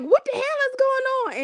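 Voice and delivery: shrill retold demand from an angry mom; imitating mom yelling. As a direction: mom bursts in on the fight, mock-scolding high. Mother catches kids fighting.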